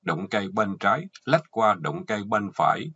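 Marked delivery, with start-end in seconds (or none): none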